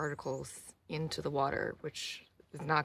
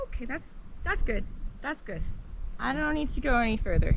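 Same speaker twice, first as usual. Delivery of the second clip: higher, nervous; pitched-up and uneasy; in ice cold water; 7 semitones higher, 5 dB louder than usual. Real, not acted.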